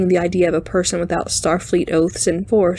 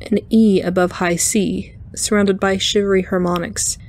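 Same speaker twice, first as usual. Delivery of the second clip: ordinary delivery, nothing marked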